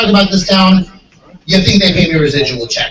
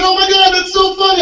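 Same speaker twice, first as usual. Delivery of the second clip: laughing bumpkin; 12 semitones higher than usual. Retold or imitated. Bumpkin laughing at the comedian's explanation in a high voice. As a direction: cackle like he finally gets it. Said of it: "He starts laughing".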